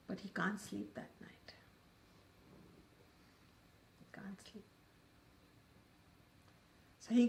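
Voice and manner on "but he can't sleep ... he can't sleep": hushed whisper, dropping away; suspenseful whisper. A narrator's reading. Storyteller builds suspense for the audience, whispering. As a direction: whisper it to draw the listeners in.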